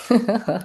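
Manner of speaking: easy little laugh; light chuckle. Real, not acted. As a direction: chuckle briefly after a question to the group. Chuckles after asking the audience.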